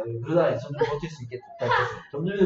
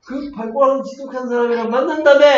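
loud, high-pitched accusing call; confronting friend; 8 semitones higher, 7 dB louder than usual. Acted. Friend who spotted the secret date, loudly confronting her. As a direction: act out a friend catching someone out, loudly. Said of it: "초이가 막 보고".

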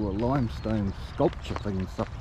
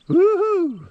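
much higher, loud whoop; joyful whoop; 7 dB louder than usual. Real, not acted. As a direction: whoop out to the hills. Cheering at the view.

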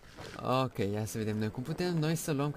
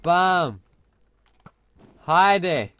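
loud, high hitting cries; battle shouts; 6 semitones higher, 13 dB louder, much slower than usual. Real, not acted. Gamer shouting while hitting the dragon, loud. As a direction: shout each strike.

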